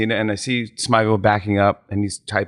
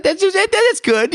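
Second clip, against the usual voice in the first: Smigel voice; slower than usual. Retold or imitated. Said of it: "He starts laughing".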